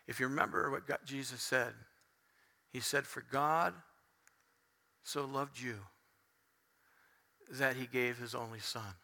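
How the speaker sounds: very soft, low, with long pauses; hushed solemn preaching. Acted. Preacher telling congregation of Jesus on the cross, hushed. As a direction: let it sink into near silence.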